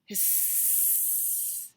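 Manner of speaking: soft, long sustained hiss; hissing snake. Acted. Snake answers Cow in a story, hissing. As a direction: stretch the s out like a snake. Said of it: "said Snake".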